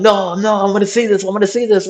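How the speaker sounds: high, eager friend's voice insisting on staying; voicing the hurt friend begging to stay; mock excited pleading. Injured friend refusing to leave, high and eager.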